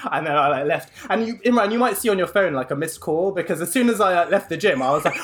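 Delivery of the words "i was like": normal talking voice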